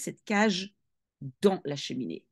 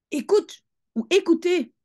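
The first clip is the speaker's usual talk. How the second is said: Mathilda hushing; 9 semitones higher, 4 dB louder than usual. Acted. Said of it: "Chut, elle disait".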